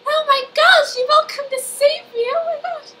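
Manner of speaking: very high, breathless damsel-in-distress voice; princess voice. Retold or imitated. Claudia as the princess greeting her rescuers, in a very high gushing voice. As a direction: gush like a rescued fairy-tale princess. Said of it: "It didn't even sound like her voice".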